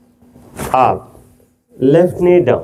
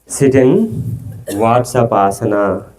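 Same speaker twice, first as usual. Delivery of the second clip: calm teacher easing the class into a pose; slow, drawn out; 5 semitones lower, much slower than usual. Shown as a demonstration. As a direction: stretch every word of Vatsapasana out slowly. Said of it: "yoga pose instruction". (నెమ్మదిగా సాగదీసి చెప్పిన ఆసనం పేరు)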